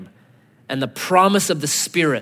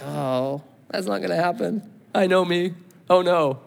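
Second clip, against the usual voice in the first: discouraged son; 3 semitones higher than usual. Retold or imitated. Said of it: "hang his head and be like".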